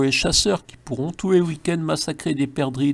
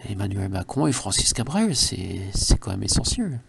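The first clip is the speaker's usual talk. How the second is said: lower, hushed mock-solemn announcement; 6 semitones lower, 5 dB quieter than usual. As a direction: play it like a grave secret, low and soft. Mock-solemn musical news.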